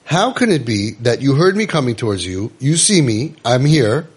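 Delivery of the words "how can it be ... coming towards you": usual speaking voice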